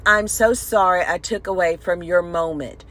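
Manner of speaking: usual speaking voice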